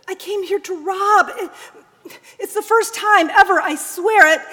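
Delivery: high, loud tearful pleading; a son choking back sobs of shame; anguished son. Acted. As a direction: break down confessing to his mother. Son's anguished confession.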